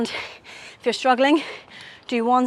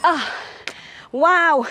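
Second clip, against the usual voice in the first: relieved exhale; 5 semitones higher than usual. Real, not acted. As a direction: let out the burn after holding. Squat hold just ended.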